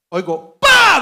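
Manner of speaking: loud, high imitated blast "pa"; explosion bang. Telling the audience about the blast, a loud imitated bang. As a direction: burst out with a sudden explosion noise.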